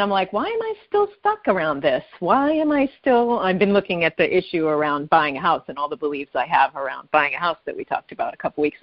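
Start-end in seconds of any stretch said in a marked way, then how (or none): 0.3-3.4 high-pitched mock exasperation; exasperated self-questioning. Caller quoting her own stuck thoughts, exasperated. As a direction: ask it high, in mock frustration at yourself. Acts out frustrated question to herself.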